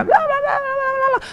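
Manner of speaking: high, drawn-out babbling; mock chatter. Mimicking the woman in the shop, high endless babble. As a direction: imitate someone yammering on and on.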